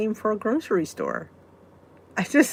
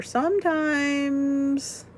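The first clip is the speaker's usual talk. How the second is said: drawn out; 5 semitones higher, much slower than usual. Real, not acted. Drawn-out word.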